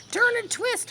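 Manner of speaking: high, loud honk of an order; honking goose. A narrator's reading. Goose honking at Wilbur to twist, high and loud. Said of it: "honked the goose".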